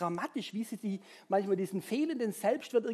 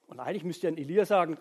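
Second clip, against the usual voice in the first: usual speaking voice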